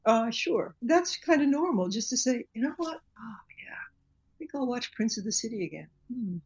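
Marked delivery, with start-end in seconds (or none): 2.6-5.9 half-whispered musing to oneself; hushed whisper. Retold or imitated. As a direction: drop to a hush as if thinking aloud. Retelling own thought, whispered aside.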